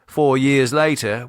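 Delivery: plain reading voice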